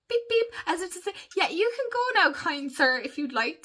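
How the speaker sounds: lightly voiced gentleman driver; mock polite driver. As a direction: play the politest driver in Scotland. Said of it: "the most civilized, polite beep".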